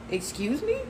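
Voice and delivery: incredulous, rising in pitch; taken aback. Retold or imitated. Storyteller answering the girlfriend's rejection, taken aback.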